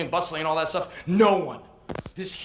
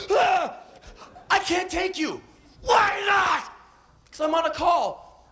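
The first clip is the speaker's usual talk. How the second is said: squeaky shouted back-and-forth; high shouted argument; 12 semitones higher than usual. Retold or imitated. Tourist and cabbie shouting through the window. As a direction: play both sides of a shouted quarrel.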